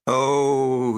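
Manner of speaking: a low, lightly voiced sick moan from the tent; trembling groan. Sick soldier moaning from his tent, mild groan. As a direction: sound ill and miserable. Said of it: "from his trembling tent".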